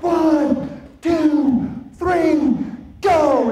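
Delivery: loud, high hype-man count to go; shouted countdown. Shown as a demonstration. Speaker showing the crowd the count, shouting. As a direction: bark the count out to fire up the crowd. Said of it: "and you're gonna yell".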